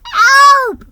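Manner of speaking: very high, loud desperate cry; drowning elf crying for help; shaky cry for help. A narrator's reading. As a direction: scream for help from the water. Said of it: "he cried in a shaky voice".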